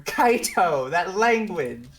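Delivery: mother scolding from the next room; mom yelling from another room. Retold or imitated. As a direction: yell it like mom down the hall. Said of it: "You just hear a scream from the other room".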